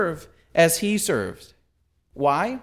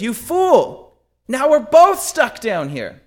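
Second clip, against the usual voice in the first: loud, high-pitched scolding; acted exasperation; 7 semitones higher, 7 dB louder, slower than usual. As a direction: yell at the fool who jumped in. Trapped man scolds the jumper.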